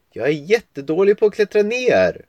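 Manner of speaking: put-on high small-girl voice admitting a weakness; sheepish call from a branch; little girl. Girl in the tree replies.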